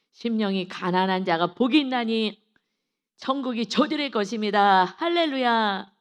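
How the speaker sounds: loud, ringing proclamation; preaching. Real, not acted. Preacher declares the beatitude to congregation, preaching.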